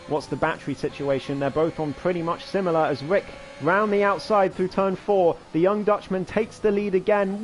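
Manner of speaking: normal talking voice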